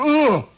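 high, sudden grunt; loud pained "ugh". Retold or imitated. Player's leg just went, acted pained grunt. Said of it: "It just went".

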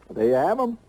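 ordinary talk, nothing marked

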